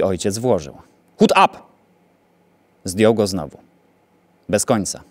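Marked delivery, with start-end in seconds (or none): 1.2-1.6 high, loud screeched order; screeching command. A narrator's reading. Fat German screeching orders at the father. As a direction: shriek it, losing patience. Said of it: "skrzeczał gruby Niemiec".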